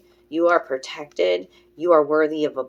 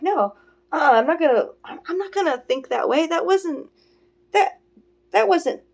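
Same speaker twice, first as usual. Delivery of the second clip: high-pitched refusal; 10 semitones higher than usual. Retold or imitated. Retells refusing her negative thoughts.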